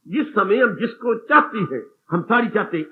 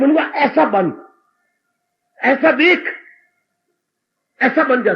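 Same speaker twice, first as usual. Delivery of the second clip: pleading with the mind; 4 semitones higher, 4 dB louder than usual. Acted. Preacher addressing the mind.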